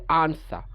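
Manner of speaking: British আনসার with long open AH, silent R; British pronunciation. Shown as a demonstration. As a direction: say আনসার as AHN-suh. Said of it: "ব্রিটিশরা বলে". (আনসার-এ লম্বা আ, British উচ্চারণ)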